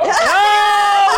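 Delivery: sudden high yelp of excitement; excited shout. Real, not acted. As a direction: yell out in excitement. Reacting to the hot wing.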